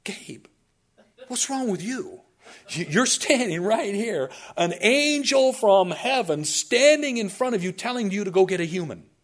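high, loud, exasperated disbelief; mock incredulous protest. Man arguing with angel standing before him, incredulous. Imagined incredulous objection to Gabriel.

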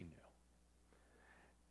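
slow, hushed certainty; whisper. Acted. Preacher whispers the answer to his own question, slowly. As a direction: whisper it like a secret.

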